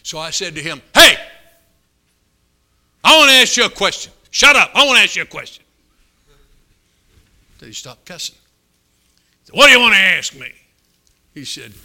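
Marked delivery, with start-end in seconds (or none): 0.9-5.6 high, booming shout over someone's cussing; loud, forceful yell to get attention; shouted demand. Retold or imitated. Preacher shouts down cussing old man. 9.5-10.5 loud, higher snap back; gruff retort at full volume; irritable shout. Retold or imitated. Cussing old man answers back.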